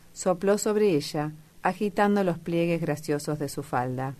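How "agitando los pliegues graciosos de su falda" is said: plain reading voice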